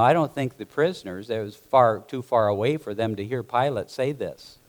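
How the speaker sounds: ordinary talk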